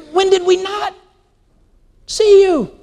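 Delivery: high-pitched, incredulous protest; baffled goats. Acted. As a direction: protest in disbelief, pitched right up. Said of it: "And the goats say".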